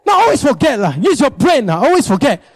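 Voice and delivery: high, exasperated mock berating; nagging scold. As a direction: scold a forgetful husband, hammed-up. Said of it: "you say this kind of thing".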